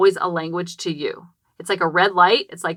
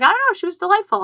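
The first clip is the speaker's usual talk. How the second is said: other parent; 12 semitones higher, faster than usual. Retold or imitated. Mom voices another parent.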